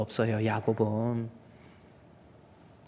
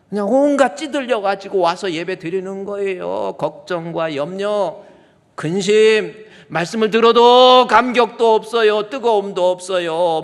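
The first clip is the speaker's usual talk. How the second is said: louder, higher rebuke of cold worship; fervent preaching; 6 semitones higher, 10 dB louder than usual. Acted. Preacher scolding a joyless congregation, fervent. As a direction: press the point hard from the pulpit.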